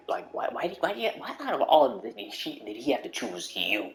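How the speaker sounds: normal talking voice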